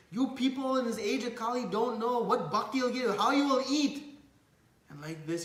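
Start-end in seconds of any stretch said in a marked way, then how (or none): 0.1-4.1 high, loud acted outrage; angry sannyasi. Sannyasi rebukes young Chaitanya over bhakti, angry. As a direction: scold them in mock anger. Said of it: "the sannyasi starts to become angry".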